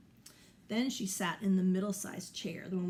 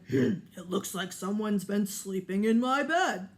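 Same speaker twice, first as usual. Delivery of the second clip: louder, big papa bear grumble; 7 dB louder than usual. Acted. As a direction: play the big bear finding his bed used. Papa Bear voiced in story.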